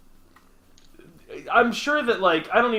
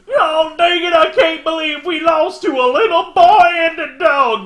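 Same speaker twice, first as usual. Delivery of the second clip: much higher, loud mock Texan shout; Texas accent; 12 semitones higher, 9 dB louder than usual. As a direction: play a sore-losing Texan manager yelling. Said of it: "They're, like, from Texas".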